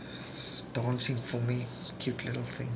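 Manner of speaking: soft, tender aside; mother whispering fondly about her dancing daughter. Real, not acted. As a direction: whisper it fondly, almost to yourself. Watching daughter dance fondly.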